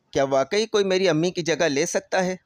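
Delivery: ordinary delivery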